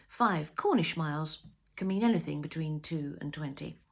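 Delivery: ordinary delivery, nothing marked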